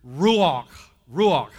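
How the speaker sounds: Ruach said slowly and firmly as a vocabulary word; demonstrated Hebrew word. Shown as a demonstration. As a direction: teach "Ruach" loudly, leaving space after it. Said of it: "have a little Hebrew lesson here".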